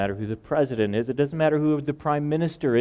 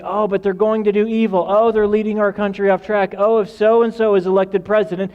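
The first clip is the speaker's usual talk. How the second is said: fearful worrier; 7 semitones higher, 6 dB louder than usual. Retold or imitated. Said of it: "That's what people who have election infection say".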